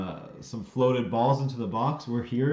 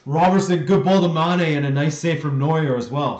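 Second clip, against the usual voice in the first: loud, raised-pitch match call; excited play-by-play; 4 semitones higher, 8 dB louder than usual. Real, not acted. Watcher calling a good ball and a save, excited shout. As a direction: call the chance loudly, riding the excitement.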